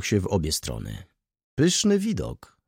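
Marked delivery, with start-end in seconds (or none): 1.5-2.6 high, drawn-out cry of admiration; admiring high-pitched call; delighted exclamation. A narrator's reading. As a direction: exclaim it slowly, pitched well up. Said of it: "zawołała pani Wąsowska".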